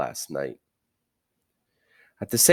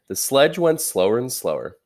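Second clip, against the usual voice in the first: ordinary delivery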